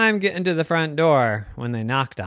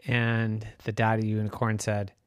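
ordinary delivery

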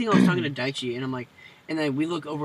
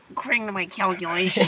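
nerd voice; 4 semitones higher than usual. Retold or imitated. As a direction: do the classic nerd voice. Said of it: "the stereotypical, like, nerd voice".

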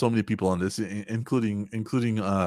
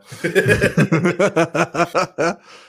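high, loud bursting laugh; 7 semitones higher, 7 dB louder than usual. Real, not acted.

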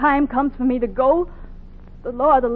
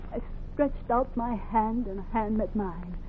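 usual speaking voice

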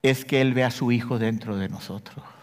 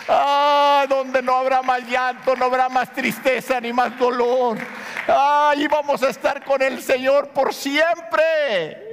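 preaching; 8 semitones higher than usual. Acted. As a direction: lift them up with the promise of heaven. Rapturous preaching of heaven. (predicación exaltada sobre el cielo)

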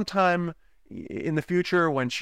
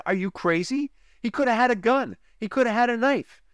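imitating scared wife; 10 semitones higher than usual. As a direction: voice the wife's fear, pitched up. Wife scolding him.

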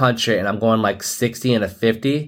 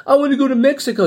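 put-on high, pleading kid voice; a mock kid whining; whiny kid; 3 dB louder, much faster than usual. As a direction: mimic a teen begging to go. Parents hearing a kid's request.

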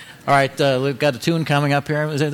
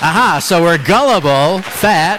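louder, higher, slower mock outrage; 5 semitones higher, 6 dB louder, slower than usual. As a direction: play it hurt, hamming it up.

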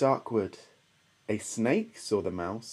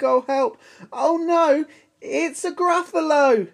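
high, loud cry of alarm; mock panic; 14 semitones higher, 6 dB louder than usual. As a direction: shriek in storybook fright. Mouse sees the Gruffalo.

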